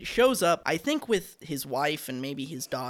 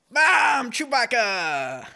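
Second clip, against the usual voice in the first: loud, high outburst, name stretched slowly; deliberately awful creature impression, shouted out; mock impression, punched high then drawn out; Chloe's Chewbacca impression; 7 semitones higher, 4 dB louder, much slower than usual. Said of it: "gonna do Chloe's impression".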